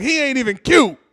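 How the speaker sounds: high, loud mock put-down; hammed-up indignant woman; outspoken girlfriend. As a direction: yell a scornful insult as her friend. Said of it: "One girl was like".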